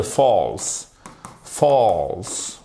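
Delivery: "false" stretched as FAWLS, falling; throaty vowel. Shown as a demonstration. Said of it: "ça se fait vraiment avec la gorge". (« false » allongé, fait avec la gorge)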